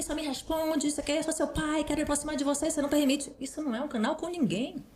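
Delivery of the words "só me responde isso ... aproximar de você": mock pushy parent, pitched much higher; demanding parent. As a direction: imitate a parent badgering the teen. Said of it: "que não é você ficar assim".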